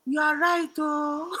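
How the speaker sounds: put-on Nigerian-accented mother conceding, drawn out; imitating Nigerian mom. Nigerian mom admitting her daughter is right, conceding. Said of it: "And it's my mom".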